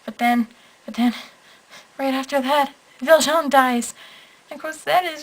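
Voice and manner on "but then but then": normal talking voice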